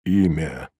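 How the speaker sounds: low, soft, ailing demand; dull, sick voice. A narrator's reading. Sick procurator questioning the prisoner, dull and low. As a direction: let the word drop, dull and pained. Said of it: "Прозвучал тусклый больной голос".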